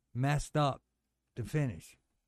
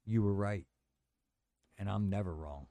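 lightly voiced Pat Patterson; 6 semitones lower than usual. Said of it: "Like that".

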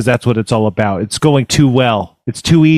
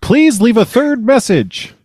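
higher-pitched, deliberate phone-menu voice; automated voicemail system; 10 semitones higher than usual. Acted. Voicemail machine asks caller for another message, high automated prompt. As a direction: recite it like a recorded prompt.

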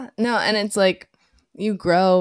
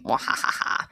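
low breathy villain cackle; gloating laugh; 8 semitones lower than usual. Real, not acted. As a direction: gloat with a hushed evil laugh. Gloats after being right.

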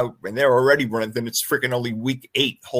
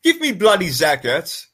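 slow, higher mock British accent; 4 semitones higher than usual. Picking a player to amuse co-hosts, exaggerated British accent. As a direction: ham up the British, drawn out. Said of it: "A little bit on the accent".

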